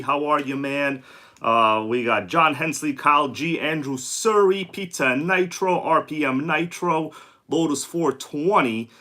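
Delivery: ordinary talk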